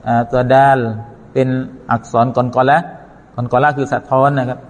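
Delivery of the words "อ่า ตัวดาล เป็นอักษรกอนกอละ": ordinary delivery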